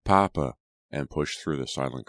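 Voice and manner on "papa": desperate shout for a father. A narrator's reading. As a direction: call out urgently, raising the second cry. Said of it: "Jonathan shouted".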